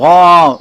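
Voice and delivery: a loud, high mock bellow of cattle; loud cow sound. Preacher imitating cattle lowing in the pasture, loud mock bellow. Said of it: "then you hear a loud sound".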